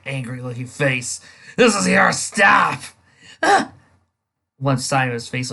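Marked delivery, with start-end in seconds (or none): 1.6-2.8 slow, loud, higher bark of a bus driver; furious driver shouting; angry shout. Acted. 3.4-3.7 high, loud gasp-cry of fright; sharp frightened yelp; startled cry. Acted. Dick sees horrifying face.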